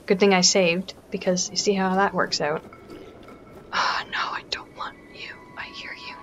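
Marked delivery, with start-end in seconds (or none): 3.7-6.1 quiet, breath-only near-whisper; hushed sneaking. Real, not acted. Player to a lurking enemy, hushed. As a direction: keep it hushed so they don't hear.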